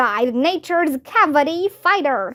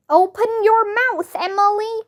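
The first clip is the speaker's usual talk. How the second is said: higher-pitched mock shout; 7 semitones higher than usual. A narrator's reading. Neil orders Emily to open up, shouting. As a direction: call it out high, without full volume. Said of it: "shouted Neil".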